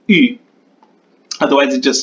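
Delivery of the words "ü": ü said as a high front rounded vowel, lips tightly rounded. Shown as a demonstration. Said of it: "a front high rounded vowel".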